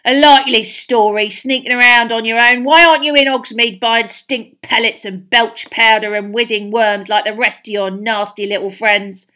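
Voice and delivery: snarling suspicious caretaker; scornful snarl. A narrator's reading. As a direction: spit out the accusation with contempt. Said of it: "spat Filch".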